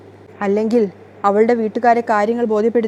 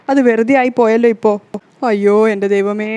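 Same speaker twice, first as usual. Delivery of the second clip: lament; 4 dB louder than usual. Acted. Mother complaining to Rekha, loud wail. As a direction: moan to heaven in despair.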